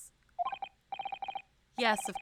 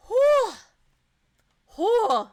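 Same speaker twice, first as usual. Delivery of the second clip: loud high exhale; shaky exhale; 12 semitones higher, 14 dB louder than usual. Real, not acted. Player calming after tears, loud exhale. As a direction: blow out to calm down. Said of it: "I'm cool".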